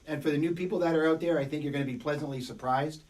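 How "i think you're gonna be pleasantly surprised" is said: ordinary talk, nothing marked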